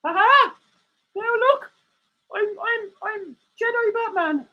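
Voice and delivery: high squeaky meerkat puppet; high puppet voice for Alan. Acted. Streamer voicing his meerkat puppet, high and cheeky.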